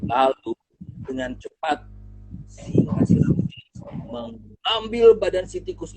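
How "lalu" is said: usual speaking voice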